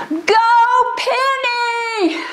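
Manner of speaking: high called-out cheer across a hall; dad's shout. Retold or imitated. Father cheering daughter from dark audience, high call. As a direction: call out to your daughter far across the room. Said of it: "shouts in this huge hall".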